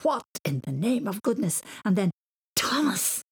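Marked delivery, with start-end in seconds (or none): 0.0-1.6 an understated indignant shout; mild shout. A narrator's reading. Dad jumps out of the car from a wet seat, mildly shouting. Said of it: "He jumped out shouting". 2.6-3.2 exasperated high-pitched call; angry shout. A narrator's reading. Dad yells for his son after sitting on a wet seat, exasperated shout. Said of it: "He jumped out shouting".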